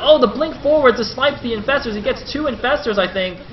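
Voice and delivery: high, loud burst of excitement; thrilled esports caster in full flow; excited play-by-play. Real, not acted. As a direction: call the big play as it happens. Live call of a sudden play.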